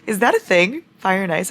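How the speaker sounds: usual speaking voice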